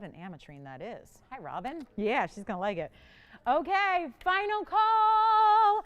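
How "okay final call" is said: high, loud final call with a long-held last word; sing-out holler, drawn out. Real, not acted. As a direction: belt it out like an auctioneer's last call. Host calls out last chance.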